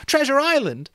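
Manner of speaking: high, loud eager shouts; children shouting with excitement; excited clamor. Acted. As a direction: boys calling out book titles over each other. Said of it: "At once there was a clamor".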